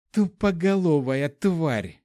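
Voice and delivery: slow growled insult; growling. Acted. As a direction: snarl it out in rage. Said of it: "зарычала Фэй".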